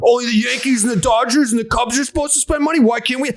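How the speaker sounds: sarcastic fan mimicry; whiny fan. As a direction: imitate an indignant fan. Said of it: "Be like".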